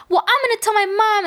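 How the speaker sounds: high, quick imitation of a child; tattling kid. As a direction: mimic a kid threatening to tattle. Acting the kid threatening to tattle.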